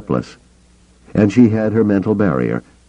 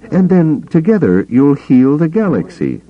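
higher-pitched woman's character voice; raised, sceptical character voice; Mara's character voice; 10 semitones higher, 4 dB louder than usual. A narrator's reading. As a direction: lift it high for her line. Narrator voices Mara to Caboth.

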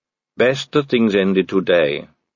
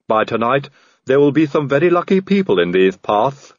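deferential Igor henchman; Igor servant; 3 semitones higher than usual. Acted. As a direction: play the obliging Igor. Igor tells of spare parts.

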